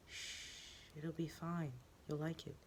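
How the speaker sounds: soft, hushed and coaxing. Acted. The voice in his flashback, hushed and coaxing. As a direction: soothe him into giving in.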